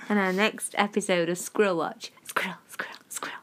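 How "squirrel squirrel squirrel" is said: breathy whispered chant; whispering. Pretend. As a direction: whisper it like a spooky jingle. Show jingle for Squirrel Watch.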